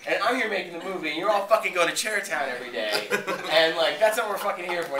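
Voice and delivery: loud, half-laughing imitation of an annoyed director; hammed-up boss telling the crew off; mock director rant. Quentin rants at the crew.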